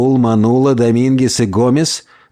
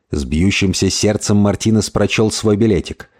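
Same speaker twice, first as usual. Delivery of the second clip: usual speaking voice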